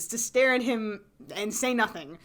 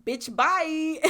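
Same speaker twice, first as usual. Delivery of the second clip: dismissive brush-off; 6 semitones higher, 4 dB louder than usual. Retold or imitated. Acted dismissive brush-off quote.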